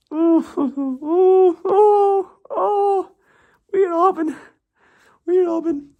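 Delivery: very high falsetto cooing over the name; friend's teasing coo. Retold or imitated. Korean friend teasing Robin with a high falsetto coo. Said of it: "my Korean friend used to always call me".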